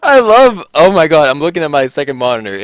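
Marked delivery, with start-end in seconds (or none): 0.0-1.3 excited. Real, not acted. Cracking up at the screen.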